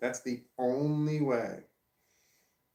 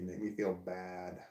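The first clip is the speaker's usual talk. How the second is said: ordinary delivery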